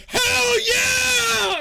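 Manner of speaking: very high, long, full-force holler; rowdy Chad yell. Acted. Chad answering the champion's roar, screaming. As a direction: break the silence with a huge drawn-out whoop. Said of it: "One of the Chads goes".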